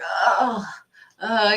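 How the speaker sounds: imitating a kid groaning under a heavy bag; exaggerated, mildly put-on effort groan; mock straining. Kids pretending the bag they hold is heavy, mock straining.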